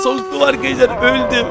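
mock electrocution cry. Electrocuted in the game.

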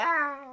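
high, loud cry of revulsion; mock horrified scream. Reactor picturing exposed organs and flesh, mock horrified scream. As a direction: recoil with a hammed-up scream. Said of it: "se le ve la carne".

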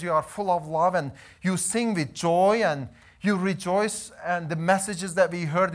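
normal talking voice

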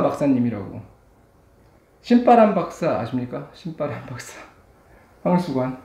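chewing between words; slurping noodles while talking; mouth full of noodles. Real, not acted.

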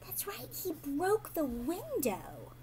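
hushed, slow reveal; slow whisper. Acted. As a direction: slowly whisper the bad news. Dramatic reveal to children.

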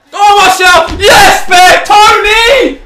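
high, loud ecstatic cheering; real fan roaring at a goal; jubilant shouting. Real, not acted. Fan celebrating a Martial goal, jubilant shouting.

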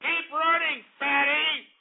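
mock heckler yell. Imitating drivers heckling a jogger with a mock yell. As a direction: taunt like a driver out the window. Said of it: "You got them yelling".

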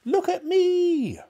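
put-on very high, slow, loud old woman's shout; shouting old grandma. Grandma jealous of the hen, shouting very high and loud. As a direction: screech for attention from the roof. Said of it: "Grandma shouted from the rooftop".

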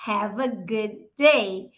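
"Have a good day" slow, "good day" blended as gudei; linked good day. Shown as a demonstration. Teacher modelling good day joined as one word. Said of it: "Tá vendo como good day".

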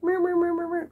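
high playful meowing; mock cat meows. To viewers about her worried brain, high playful meows. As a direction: meow like a distracted cat. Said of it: "my brain's just like".

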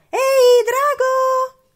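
very high, very loud shriek; piercing shout to wake a sleeper; full-force yell. A narrator's reading. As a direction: scream it as loud as you can. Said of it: "strillò più forte che poteva".

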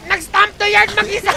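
high and loud, laughing as he yells; excited shout. Real, not acted. Caster yelling to viewers about a solo stomp, excited. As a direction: erupt over the play for the crowd. Caster hyping a big play.

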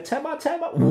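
high, pleading little voice; Kaj calling. A narrator's reading. Kaj begs Bamse to grab him, high and pleading. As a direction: call out to not be forgotten. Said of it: "han stod der og sagde".

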